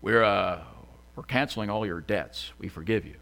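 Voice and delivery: low, halting presidential voice; Obama. Acted. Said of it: "I don't know what that voice is".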